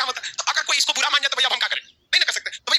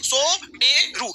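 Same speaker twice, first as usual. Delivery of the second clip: drawn out; 2 semitones higher, much slower than usual. Shown as a demonstration. Teacher drills the answer.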